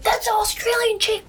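high-pitched excited exclamation; mock a thrilled guy spotting someone at the bar. Man excitedly hailing her at bar.

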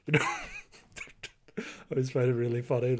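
normal talking voice

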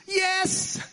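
high-pitched excited exclamation; acted eager yes. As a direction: squeal it like an eager convert. Acting out Maggie's eager answer.

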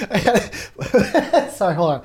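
high, loud words broken by laughing; helpless laughter. Real, not acted. Apologizing to viewers mid-fit, laughing helplessly. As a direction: fight to speak through the laughs. Laughing too hard to talk.

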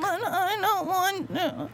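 high, slow whiny plea; a man pleading with his other voice, whimpering; whimpering reluctant voice. Retold or imitated. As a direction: beg like a frightened child. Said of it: "it was something like this".

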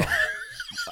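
high burst of laughter; laughing. Real, not acted. Host reacts to absurd grape pie idea, laughing. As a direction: crack up at a silly joke.

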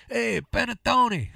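high, slow, hammy shout of panettone; silly shout. Pretend. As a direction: belt it out like a goofy announcer. Silly shouted food name.

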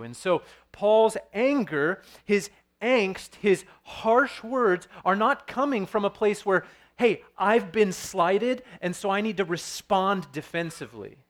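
slow, loud, emphatic sermon delivery; forceful preaching. Acted. Pastor preaching to his congregation about Paul, forceful emphasis. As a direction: press each word home from the pulpit.

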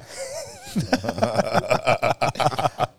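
rising burst of laughter; real amused laugh; laughing at own joke. Real, not acted. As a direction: laugh at your own punchline.

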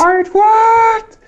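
very high, slow, loud mimicry; mock high shriek. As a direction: shriek it back in mockery. Mocks friend's question.

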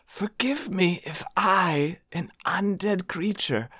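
hushed, high, airy undead creature; whispered undead voice. Acted. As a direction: breathe it like a timid undead spirit. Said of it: "it just says".

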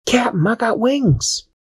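bursting with delight; excited Dashi. Acted. Dashi showing the captain her wings, high and thrilled.

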